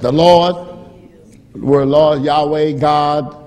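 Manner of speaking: loud, ringing proclamation of the Lord; preacher calling it out to the church; full-force preaching. Acted. Preacher to his congregation, full-force proclamation.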